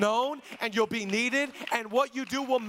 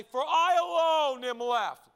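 slow, louder, higher lament as Elijah; Elijah's lament; 5 semitones higher, 6 dB louder, slower than usual. Retold or imitated. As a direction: cry out Elijah's words slowly. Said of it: "what did Elijah say?".

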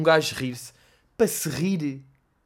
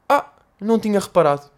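higher, louder dopey reply; oblivious Hugo; 5 semitones higher, 5 dB louder than usual. Retold or imitated. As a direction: play it clueless and surprised. Hugo's oblivious answer. (o Hugo, distraído e surpreendido)

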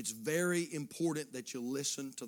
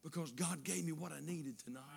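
softly voiced, much quieter quote; confiding churchgoer; 8 dB quieter than usual. Churchgoer admits to preacher he nearly stayed home, soft confiding. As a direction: confide it gently, almost under the breath. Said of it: "people come and told me".

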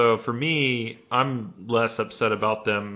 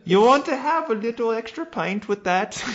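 very high nanny lilt; Missus Doubtfire; 12 semitones higher, 3 dB louder than usual. Acted. Nanny offering an extra pint, in a high put-on lilt. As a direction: offer it in a hammed-up falsetto. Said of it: "I'm Missus Doubtfire".

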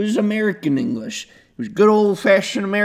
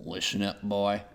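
very low, quiet Southern menace; soft, deep mock-Southern threat; backwoods tough; 10 semitones lower, 7 dB quieter than usual.

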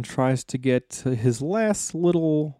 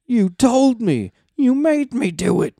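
hissed; 11 semitones higher, 5 dB louder than usual. A narrator's reading. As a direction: spit the words out in rage. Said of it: "he hissed".

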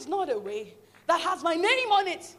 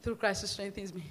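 softer, lower muttered reminder; quiet self-talk; 7 semitones lower, 11 dB quieter than usual. Retold or imitated. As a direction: quietly encourage yourself. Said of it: "I said".

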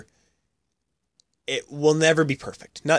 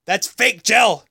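high taunting heckle at a ref; mock heckling; 7 semitones higher, slower than usual. Fan heckling referee Kerry Fraser about his hair, high mock jeer. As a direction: jeer at him like a fan, pitched up. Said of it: "and said".

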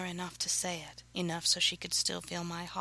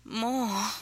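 higher, breathy gasped request; gasped plea; 4 semitones higher, 3 dB louder than usual. A narrator's reading. Woman urging her lover on, breathy gasp. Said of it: "she gasped".

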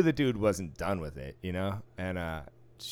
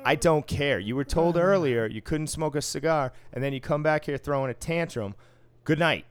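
stern telling-off; 4 semitones higher, 4 dB louder than usual. Retold or imitated. Casino worker shuts down an arguing smoker, stern and emphatic. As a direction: lay down the law, then dismiss him.

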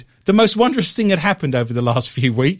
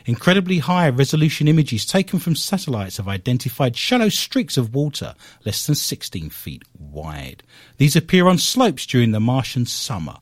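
lower, measured newsreader delivery; news read; 6 semitones lower than usual. Acted. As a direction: read out the science bulletin. Reads a news item.

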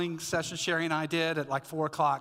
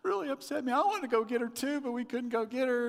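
high-pitched imitation of crying; mock-tearful high voice; tearful wife; 9 semitones higher, faster than usual. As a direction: whine it high, mimicking an upset wife. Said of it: "she is crying, and she's like".

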